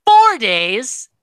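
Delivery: high, loud indignant rant; mock Hardy's outrage at the vote; outraged Hardy. Host imitating angry houseguest Hardy, loud indignant whine. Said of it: "Hardy's like".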